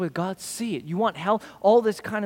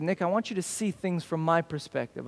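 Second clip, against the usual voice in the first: gentle voice of God; 3 semitones lower, 7 dB quieter than usual. Retold or imitated. Preacher voicing God to himself.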